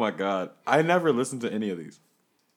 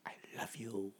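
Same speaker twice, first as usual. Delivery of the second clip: whisper; 5 semitones lower, 16 dB quieter than usual. Acted. Teasing whispered banter.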